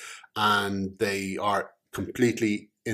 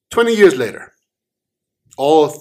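louder and higher on twenty years later; big time jump; 6 semitones higher, 10 dB louder than usual. A narrator's reading. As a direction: announce the leap in time. Narrator jumps forward in time.

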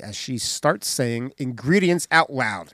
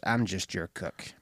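hushed whispered punchline; 8 semitones lower, 11 dB quieter than usual. Retold or imitated. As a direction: drop it to a whisper.

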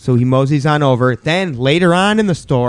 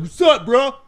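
high tough-guy bro; 9 semitones higher than usual. Retold or imitated. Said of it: "and I was like".

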